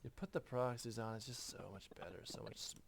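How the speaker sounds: skater surfer voice. Acted. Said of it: "maintain my skater surfer voice".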